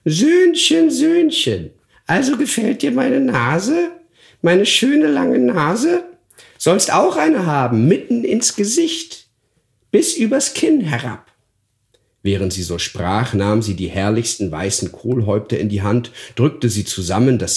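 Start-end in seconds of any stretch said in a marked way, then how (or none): 0.1-11.3 very high, sneering crone voice; hoarse old witch. Acted. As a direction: taunt the boy like a wicked hag. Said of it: "sprach mit heiserer Stimme".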